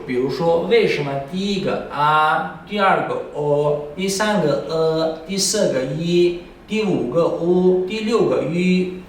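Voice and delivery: usual speaking voice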